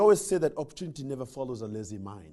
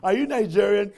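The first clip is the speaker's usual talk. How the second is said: teasing question; 4 semitones higher, 7 dB louder than usual. Retold or imitated. Mocking the concierge's question.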